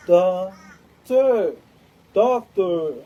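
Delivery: loud shouted calls, falling at the end; mock shouting, each word hammered; retold shouting. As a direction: yell it out like she did. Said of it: "She went".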